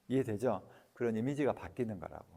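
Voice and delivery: normal talking voice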